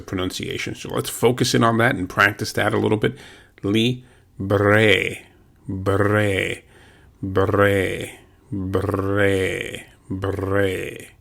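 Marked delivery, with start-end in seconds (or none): 3.6-11.1 bre with the Spanish R rolled. Shown as a demonstration. Teacher drilling bre with a rolled R for students. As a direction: roll the R in libre and bre. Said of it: "that rolling R".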